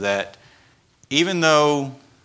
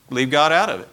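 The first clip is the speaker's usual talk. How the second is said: usual speaking voice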